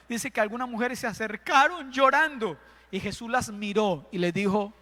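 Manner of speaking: ordinary talk, nothing marked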